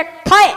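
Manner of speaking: loud, punched clang noise; imitated loud clang. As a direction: make the pot bang out loud.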